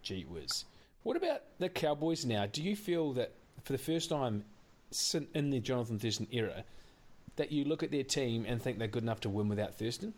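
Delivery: ordinary talk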